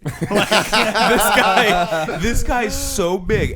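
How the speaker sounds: high, loud, slow laughter through the words; can barely get it out for laughing. Real, not acted. Telling friends about the huge guy, laughing hard.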